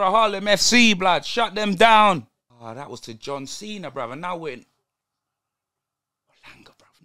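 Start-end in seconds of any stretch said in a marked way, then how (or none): 6.3-7.0 whisper. Real, not acted. Tense moment watching the match.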